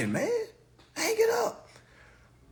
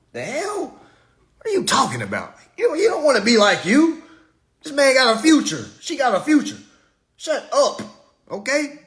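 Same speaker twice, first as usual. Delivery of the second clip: ordinary talk, nothing marked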